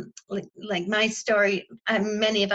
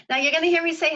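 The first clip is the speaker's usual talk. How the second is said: usual speaking voice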